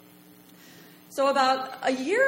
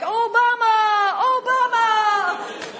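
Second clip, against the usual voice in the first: high, loud, drawn-out calls; shouting from cars; 12 semitones higher, 8 dB louder, much slower than usual. Retold or imitated. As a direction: holler it from a passing car. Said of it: "and they're like".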